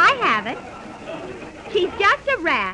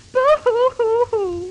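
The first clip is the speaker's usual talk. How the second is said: mock sobbing; 5 semitones higher, slower than usual.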